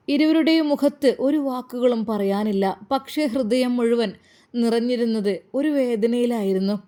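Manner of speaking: ordinary delivery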